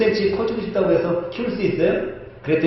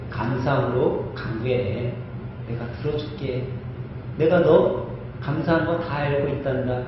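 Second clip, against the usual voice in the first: tender voice of God; 5 semitones lower, 7 dB quieter than usual. Acted. Pastor voices God's gentle words.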